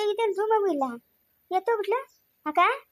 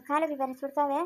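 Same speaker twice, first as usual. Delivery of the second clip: ordinary talk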